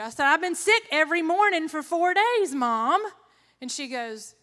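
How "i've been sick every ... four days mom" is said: loud, higher-pitched complaint; exasperated protest. Acted. Sick daughter protesting to her mother, loud and exasperated. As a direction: insist to mom, voice raised.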